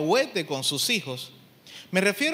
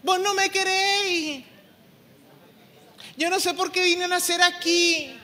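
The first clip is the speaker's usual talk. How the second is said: put-on much higher, louder whiny teen voice; mocking sulky teenager; 11 semitones higher, 4 dB louder than usual. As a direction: parody the fed-up teenager talking back. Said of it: "¿Qué es lo que va a decir el joven?".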